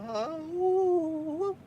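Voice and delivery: a Mortal Kombat fighter's battle cry; Liu Kang cry. Retold or imitated. Relieved after leaving the movie, Liu Kang falsetto cries. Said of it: "I sound like Liu Kang in Mortal Kombat".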